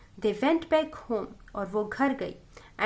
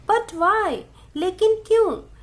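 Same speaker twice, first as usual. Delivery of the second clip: higher, louder mother's "why?"; puzzled questioning; 6 semitones higher, 5 dB louder than usual. A narrator's reading. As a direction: press for a reason, pitched up. Mother questions daughter's refusal. (हैरानी भरा ऊँचा सवाल)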